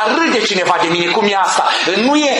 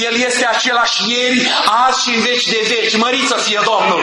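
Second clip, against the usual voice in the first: fervent preaching; 5 semitones higher than usual. Acted. Preacher proclaiming to the congregation that the Lord never changes, fervent. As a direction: preach it out to the whole church.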